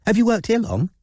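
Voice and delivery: high, bright, friendly question; bubbly posh girl voice; chirpy posh voice. Acted. Robin's put-on chirpy persona.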